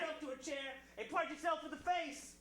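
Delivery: shouting rant. Acted. Co-host quotes letter, shouting.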